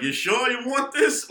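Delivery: a hint of a put-on God, high and drawn out; God's reply. God toying with him about the blood, lightly acted. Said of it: "I guess he was like".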